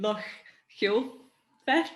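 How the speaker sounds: "Loch Gilphead" attempted haltingly, with a questioning rise; unsure pronunciation. Real, not acted. Host struggling with a Scottish place name, hesitant pronunciation. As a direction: feel your way through "Loch Gilphead", pausing mid-name. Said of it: "correct pronunciation for the listeners back home".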